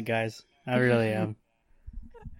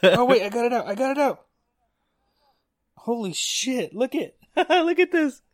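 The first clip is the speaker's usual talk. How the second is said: much higher, yelping with disgust and relief; grossed-out relief; 11 semitones higher than usual. Real, not acted. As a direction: shriek it out after spitting out the bug. Spitting out a swallowed bug.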